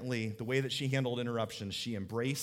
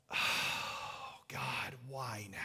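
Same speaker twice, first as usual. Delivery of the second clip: whispered despair, much softer; exasperated whisper; 3 semitones lower, 9 dB quieter, much slower than usual. Retold or imitated. Pastor voicing someone hit by bad news, whispering. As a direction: breathe it out like a groan under your breath. Exasperated inner thought quoted.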